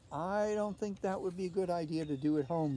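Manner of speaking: plain reading voice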